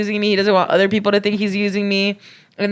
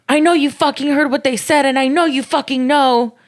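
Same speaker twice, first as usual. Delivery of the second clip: angry accusation; 6 semitones higher than usual. Retold or imitated. Angry boyfriend accusing her.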